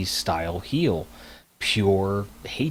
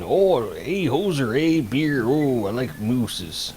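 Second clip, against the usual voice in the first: hammed-up Canadian accent, higher and louder; mock Canadian accent; 4 semitones higher, 4 dB louder, much slower than usual. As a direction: lampoon a Canadian, slow drawn-out ending. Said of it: "like my Canadian accent".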